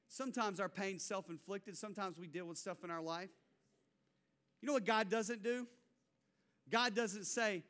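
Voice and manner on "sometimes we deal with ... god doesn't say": ordinary delivery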